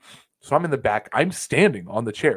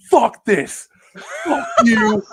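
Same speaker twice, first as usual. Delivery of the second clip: high, loud hammed-up yell; mock yell; 13 semitones higher, 8 dB louder, much slower than usual. Fan hurling bottles at the ring, mock rage shout. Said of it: "He just goes".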